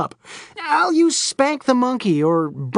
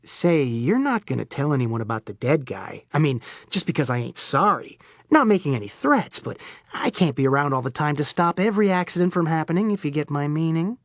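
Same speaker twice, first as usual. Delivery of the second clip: lower, softer veiled threat; different menacing voice; 4 semitones lower, 3 dB quieter than usual. Acted. Serge warning the priest to keep quiet, low soft menace. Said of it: "leaned forward with a different voice".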